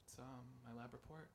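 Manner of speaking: very soft, hesitant admission; meek and quiet; sheepish answer. Retold or imitated. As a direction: answer the teacher in a small, reluctant voice. Pupil timidly answers the stern teacher.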